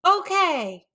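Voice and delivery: high, loud showman "Okay"; announcing. Acted. Peter announces the show to the crowd. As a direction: grab everyone's attention. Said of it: "Peter announced".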